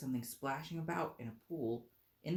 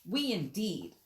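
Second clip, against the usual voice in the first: louder, higher indignant outburst; indignant cry; 7 semitones higher, 6 dB louder, slower than usual. Acted. The trembling mouse crying out at Alice, loud and indignant. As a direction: fire it back in offence. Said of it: "cried the mouse".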